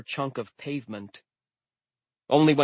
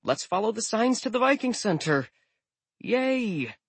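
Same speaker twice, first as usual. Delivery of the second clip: squeaky nervous; 10 semitones higher than usual. A narrator's reading. Scared narrator voicing himself.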